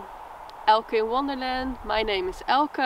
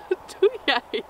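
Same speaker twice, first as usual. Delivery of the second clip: laughing high; 9 semitones higher than usual. Real, not acted. Distracted, laughing at someone's hand.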